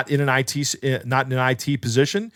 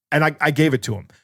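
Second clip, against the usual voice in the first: normal talking voice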